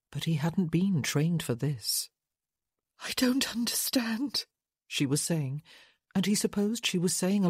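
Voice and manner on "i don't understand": high, whispery, fragile voice; bewildered grief. A narrator's reading. Widow too shocked to make sense, whispering bewildered. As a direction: say it weakly in tearful confusion.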